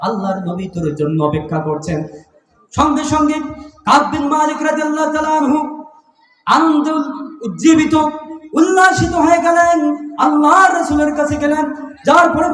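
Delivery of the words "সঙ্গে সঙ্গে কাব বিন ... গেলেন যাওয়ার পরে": high, loud, ringing narration; full-force preaching. Acted. Preacher telling of Kab's joy at the news, high exultant proclamation. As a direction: proclaim the joy on a raised chant.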